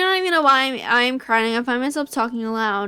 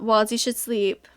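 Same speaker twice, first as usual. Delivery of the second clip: normal talking voice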